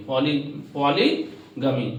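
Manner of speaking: "Poly" said alone, then "polygamy" slowly; slow teacher split, "poly" first, then "polygamy"; split into parts. Shown as a demonstration. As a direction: break "polygamy" apart slowly for the class. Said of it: "poly अलग है, गमी अलग है".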